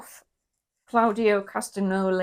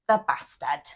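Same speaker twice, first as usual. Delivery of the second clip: lower, put-on accent on the name; deliberately avoid the posh accent; put-on non-posh accent; 5 semitones lower than usual. Shown as a demonstration. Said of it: "If I say it in my normal accent, it just sounds really posh".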